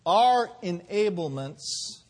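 very slow, word by word; measured declaration; slow deliberate. Acted. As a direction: weigh each word. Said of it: "preaching slow declaration".

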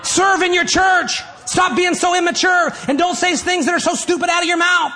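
high, loud scolding sermon shout; preaching at full force. Real, not acted. Preacher telling grumbling churchgoers off, fired-up shout. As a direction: rebuke the complainers, pitched high and punched out.

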